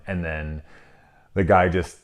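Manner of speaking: ordinary delivery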